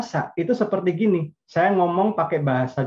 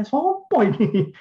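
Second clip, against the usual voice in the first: mock shocked; 9 semitones higher, much slower than usual. Shocked spouse asks in Javanese.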